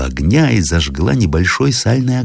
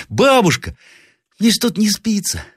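put-on very high child's voice; young boy. A narrator's reading. Grandson asks grandmother about howling outside, piping child voice. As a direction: pipe up like a curious little boy.

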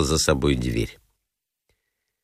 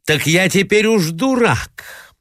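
higher, slow indignant shout, stress on уж; 9 semitones higher than usual. Acted. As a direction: hit уж, pitch up in outrage. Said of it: "кричал он". (высокий, медленный возмущённый крик с ударением на «уж»)

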